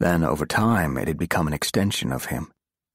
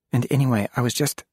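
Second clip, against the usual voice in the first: voice catching; 7 semitones higher, faster than usual. Acted. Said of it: "her voice caught in her throat".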